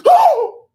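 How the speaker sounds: sharp startled gasp; shocked gasp. Retold or imitated. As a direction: gasp and squeak out oh.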